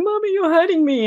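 put-on little boy begging the mum who drags him; hurt little boy. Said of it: "And he's going".

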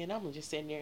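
usual speaking voice

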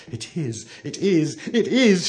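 mounting dread with each repeat; fearful whisper. A narrator's reading. Turkey telling Blue Eyes who knocks, frightened. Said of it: "her voice shaking with fear".

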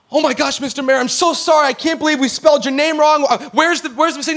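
high, rattled assistant voice; flustered apology. Retold or imitated. Assistant apologising to the new mayor, flustered. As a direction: apologise in a mock panic.

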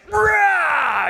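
sudden high, loud roar at the book; angry roar. Retold or imitated. Husband roaring at his wife's book in fear. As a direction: blow up with a wild roar. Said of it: "I have this overreaction".

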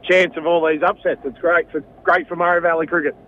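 ordinary talk

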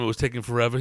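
normal talking voice

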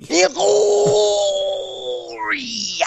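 high, drawn-out mock greeting; imitating Shaq. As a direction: copy his slow starstruck hello. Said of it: "That's how he says it".

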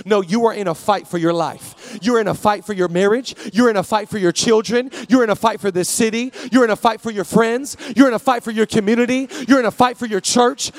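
loud repeated litany, punching "You're"; preaching at full force. Acted. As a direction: build the list like a rallying cry.

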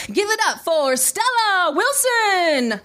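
high, drawn-out MC introduction; comedy club emcee hype; show announcer. Acted. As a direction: stretch the name out for the crowd. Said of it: "my name being announced through the speakers".

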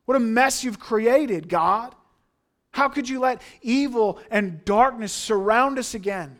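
loud, high desperate cry to God; anguished shouted lament; screaming out. Acted. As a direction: scream the complaint at heaven. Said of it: "They're screaming out".

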